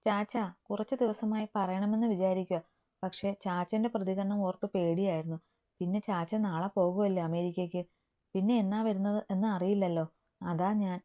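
ordinary delivery

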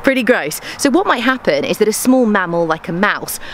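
ordinary delivery

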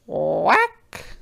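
high storybook quack, rising at the end; duck quack. A narrator's reading. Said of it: "the duck said".

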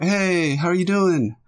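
lightly hammed-up cheery greeting. Friend greeting a newcomer to the voice chat, cheery.

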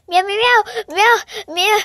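high imitated meows; cat meowing. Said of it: "this is what Foxy hears".

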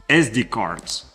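normal talking voice

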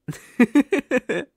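high, loud little giggle; short high laugh; bright giggle. Acted. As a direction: giggle at your own joke. Ghost joking about not eating.

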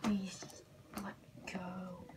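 faint whispered begging; whispered plea. Acted. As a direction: plead under your breath.

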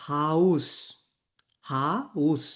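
"হাউস" with an "ow" glide and final s; German pronunciation. Shown as a demonstration. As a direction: model "হাউস" clearly, ending on s. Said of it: "এটার উচ্চারণ হচ্ছে". ("হাউস" German উচ্চারণে, শেষে স)

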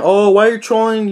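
lightly hammed-up whiny commenter; mock whiny critic. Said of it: "there's gonna be some people saying".

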